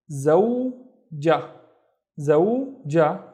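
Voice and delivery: زوجة slowly, zow, then ja; syllable by syllable. Shown as a demonstration. Teacher introducing the word for wife, زوجة slowly in syllables. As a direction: draw out and separate both syllables of زوجة.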